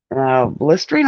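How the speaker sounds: usual speaking voice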